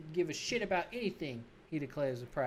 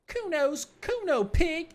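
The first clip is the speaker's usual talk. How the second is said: put-on cocky kid thumping his chest at the cop, high and loud; high, slow, bratty boast; 13 semitones higher, 7 dB louder, slower than usual. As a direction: puff up and shout it like a proud brat.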